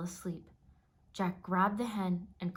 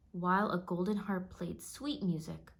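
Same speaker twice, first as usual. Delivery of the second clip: ordinary delivery, nothing marked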